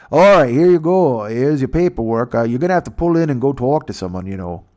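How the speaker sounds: mock Canadian guard. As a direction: play the Canadian border guard, a little over the top. Border guard voiced in story.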